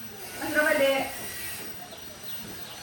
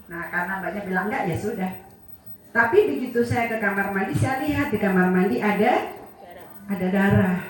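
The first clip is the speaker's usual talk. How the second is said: ordinary delivery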